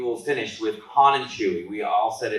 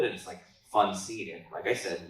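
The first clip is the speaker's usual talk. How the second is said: ordinary talk, nothing marked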